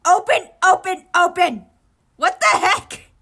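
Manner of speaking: loud, rapid furious yells; angry shouting. Acted. Okawa banging the egg, shouting angrily. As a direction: bang out each word. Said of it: "rapidly banged the egg".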